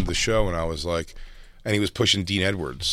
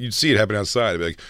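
ordinary delivery

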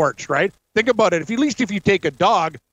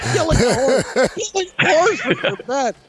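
laughing disbelief; 7 semitones higher than usual. Real, not acted. Incredulous reaction to the scheme.

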